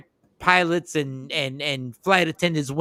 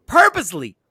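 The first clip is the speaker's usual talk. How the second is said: ordinary talk, nothing marked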